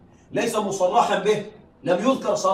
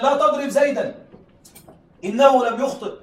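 raised, pleading order not to hit; urgent plea; 6 semitones higher, 5 dB louder than usual. Shown as a demonstration. Teacher acting someone telling another not to hit Zayd, urgent. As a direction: voice the plea to spare Zayd, higher. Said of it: "تقول لفلان".

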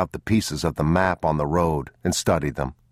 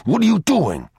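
higher, louder burst of alarm; hissed reproach; 5 dB louder than usual. A narrator's reading. Father seizing his son by the arm, urgent hissed reproach. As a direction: hiss it urgently, pitch jumping up. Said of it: "he hissed".

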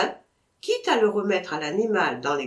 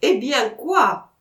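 loud, slow, affronted question; indignant challenge; 4 semitones higher, 6 dB louder, slower than usual. Acted. Vatrin to Michel who laughs at him, loud and affronted. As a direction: demand it back at the one laughing.

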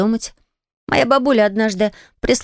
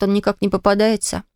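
usual speaking voice

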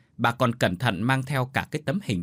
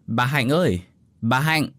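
neighbour calling out, a little louder; calling over the fence; 3 semitones higher, 4 dB louder than usual. A narrator's reading. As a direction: call to her from next door. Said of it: "gọi vọng sang từ cái rào tre".

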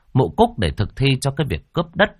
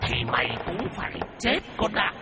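eerie ghostly hiss, breathy and unvoiced at the end; 7 semitones higher, 8 dB quieter than usual. A narrator's reading. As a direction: hiss it out like a vengeful spirit. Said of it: "mà rít lên".

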